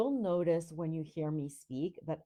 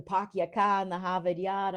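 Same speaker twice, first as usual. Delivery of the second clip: R-less Rhode Island accent, a bit louder; 2 semitones higher, 6 dB louder than usual. Shown as a demonstration. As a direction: drop every R, broad Rhode Island accent. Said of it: "Rhode Islanders have no R's".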